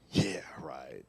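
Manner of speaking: very low, soft, whispery "Yeah, right"; varied inflection. Shown as a demonstration. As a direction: drop "Yeah, right" to a hushed low croak. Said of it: "It's how I said it".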